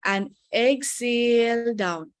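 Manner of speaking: very slow, drawn-out cue; deliberately slow. Shown as a demonstration. Teacher slowing down so students breathe well, drawn out. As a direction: stretch the words so they can breathe. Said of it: "मैं जानबूझ के धीरे बोल रही हूँ".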